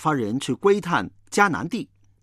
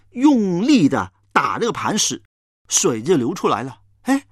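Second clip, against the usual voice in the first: normal talking voice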